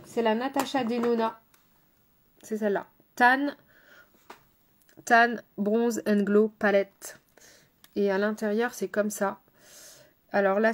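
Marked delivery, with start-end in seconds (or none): 3.2-7.2 slow, put-on English accent on the palette name; playful English accent, a bit smug. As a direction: show off an English accent, slowly. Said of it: "J'adore cet accent anglais".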